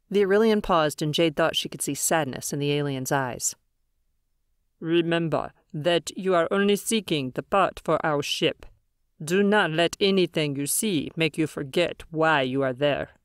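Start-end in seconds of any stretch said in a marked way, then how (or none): 4.8-13.1 measured, otherworldly alien elder; calm alien leader voice. Acted. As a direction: speak as a grave alien leader.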